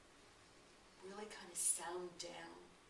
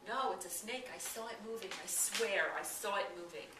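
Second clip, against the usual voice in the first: insistent; 7 dB louder than usual. Retold or imitated. Man insists he saw snake.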